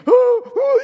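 high, loud "ooh, ooh"; hammed-up imitation of a child wailing in panic; mock freak-out wail. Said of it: "he's freaking out".

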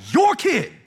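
hurt God's voice. Retold or imitated. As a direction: push the people onto Moses. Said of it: "You can tell he's been hurt".

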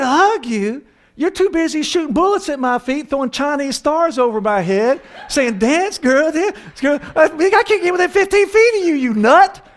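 high-pitched, hammed-up scolding; exasperated wife rant. Wife rejects husband's plea for a hug, high exasperated mock rant.

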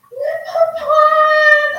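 mock tearful joy as Jane hears an old friend's voice; tearful cry of joy. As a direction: call the name like a long-lost child just found. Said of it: "her voice was shivering, she was crying".

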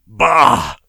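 loud, explosive dismissal; scornful snort. A narrator's reading. Posthumo dismissing the warning, snorting. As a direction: snort it with contempt. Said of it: "snorted Posthumo".

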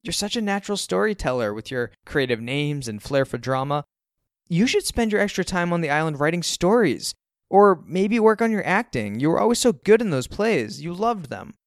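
high, loud, singsong encouragement; earnest gushing mom. A narrator's reading. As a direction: praise like a sincerely upbeat mother. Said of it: "She's serious, too, not even trolling".

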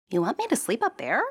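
put-on young princess, wary; Princess asking. A narrator's reading. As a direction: ask it up high, unsure. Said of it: "asked Princess".

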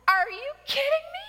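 high, fast, loud incredulous cry; disbelief. Real, not acted. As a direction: shriek it in disbelief. Incredulous at book title.

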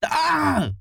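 sudden high yelp of pain; pained scream. A narrator's reading. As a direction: yelp as if stung in the eye. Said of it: "until Charlie screamed".

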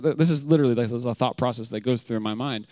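ordinary talk

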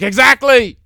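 high, loud mock preacher exclamation; loud hammed-up street preacher agreeing; excited shout. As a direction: shout it like a street preacher who just got an amen. Neighbor preaching, agreeing wildly.

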